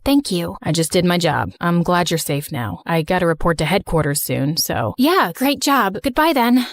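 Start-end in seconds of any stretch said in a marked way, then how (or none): 0.6-5.0 put-on deep, steady young man; calm her down in a low voice; low reassuring male voice. Slater says goodbye.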